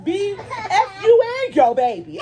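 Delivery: high, drawn-out angry comeback; indignant high retort; heated. Retold or imitated. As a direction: re-enact firing back an insult, pitch way up. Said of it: "I was heated at this point".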